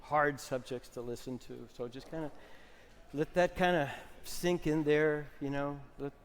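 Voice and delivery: calm workshop leader easing into stillness; soft guided exercise voice. Acted. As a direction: ease the room down gently. Leads a slumped, quiet body exercise.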